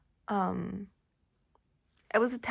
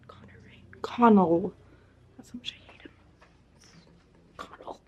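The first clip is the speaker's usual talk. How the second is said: whispering; 5 dB louder than usual. Real, not acted. Whispered name correction.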